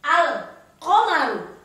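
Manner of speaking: slow "Al komaru", l distinctly sounded; clear lam. Shown as a demonstration. Teacher repeating the rule's example, slow clear "Al komaru". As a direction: separate "Al" from "komaru" with a full l. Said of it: "Alnya harus jelas".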